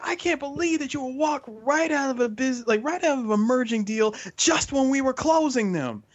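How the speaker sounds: high, put-on outraged boss voice; mock boss rant. Friend imitating the boss scolding him, high mock outrage. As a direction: whine it like a scandalized boss. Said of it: "He's like".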